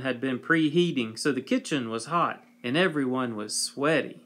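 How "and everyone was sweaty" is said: usual speaking voice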